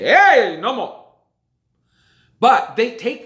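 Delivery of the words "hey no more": mock announcement. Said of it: "and then they say".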